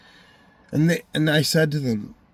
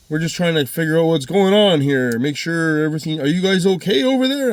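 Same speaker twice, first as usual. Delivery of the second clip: raised, louder mock-concerned voice; imitated constable; 5 semitones higher, 5 dB louder than usual. Constable phones to ask if they're okay, imitated concern. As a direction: imitate the officer checking in, pitched up.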